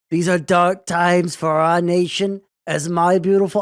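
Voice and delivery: a bad Trump that drifts toward Kennedy; attempted Trump voice. Shown as a demonstration. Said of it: "should I do it in his voice".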